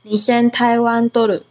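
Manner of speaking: normal talking voice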